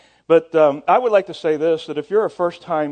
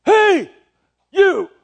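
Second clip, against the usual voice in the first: shouted call, pitched way up and louder; 14 semitones higher, 5 dB louder than usual. Retold or imitated. Player bellows at the chaplain walking past. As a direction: yell it across the plane so he can't ignore you. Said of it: "He said".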